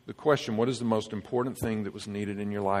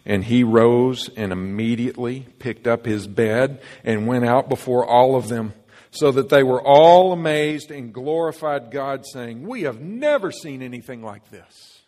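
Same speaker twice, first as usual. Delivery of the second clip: louder, measured Bible reading to the congregation; scripture reading; 7 dB louder than usual. Acted. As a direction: read the verse out with weight. Reads scripture aloud.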